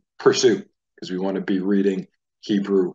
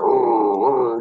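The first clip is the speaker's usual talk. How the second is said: put-on child's toy-car growl on a front R; rolling toy-car rr sound; car noise R; 3 semitones higher, 5 dB louder than usual. Shown as a demonstration. Teacher imitating a child's toy car, louder growl. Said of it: "a young child playing with a car".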